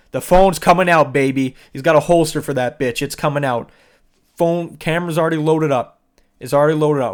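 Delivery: loud, high hype about the phone coming out; hyped up. Real, not acted. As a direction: build it like a ringside announcer. Hyping Chris pulling out his phone.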